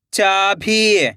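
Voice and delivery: चाभी joined as one word with long ई; blended word. Shown as a demonstration. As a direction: read चाभी whole, falling at the end. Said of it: "खींच के पढ़ना है".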